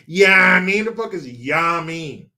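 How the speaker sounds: mock New York catchphrase, first word hit loud; teasing New York accent; mocking New York talk. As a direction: imitate the New York dudes, exaggerating the yeah. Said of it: "You guys say".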